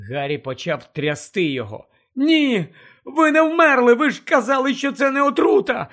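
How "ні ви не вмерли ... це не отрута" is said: high, loud, frantic pleading; desperate shouting. Acted. Harry shaking the fallen Dumbledore, shouting desperately. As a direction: shout at him to be alive.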